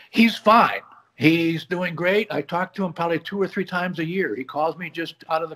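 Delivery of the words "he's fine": choking up. Real, not acted. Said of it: "without choking up about it".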